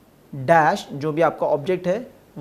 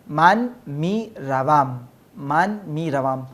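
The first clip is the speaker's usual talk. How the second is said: slow model; slower than usual. Shown as a demonstration. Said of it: "teacher slowly models conjugation".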